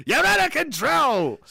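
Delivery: slow, high, loud goofy bark; stupid shouting voice. Acted. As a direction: yell it like a cartoon drill sergeant. Silly voice shouting at himself.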